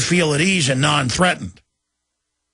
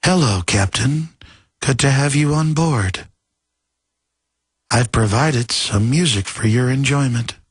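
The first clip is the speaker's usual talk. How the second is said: calm HAL; 2 semitones lower than usual. Retold or imitated. Host voices HAL greeting the captain, calm. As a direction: greet him with eerie calm. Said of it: "is trained to be real calm".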